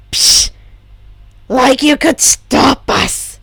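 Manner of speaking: slow, loud scoff; scoffing grumble. A narrator's reading. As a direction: scoff at the idea. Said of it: "Tomura grumbled".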